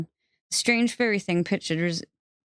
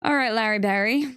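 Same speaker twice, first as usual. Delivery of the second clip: smirking; 5 semitones higher than usual. Acted. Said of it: "He could almost hear her smirk".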